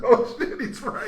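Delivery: high-pitched words through laughter; laughing realisation. Retold or imitated. Player realising the blind teammate is right, laughing. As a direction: crack up while conceding the point.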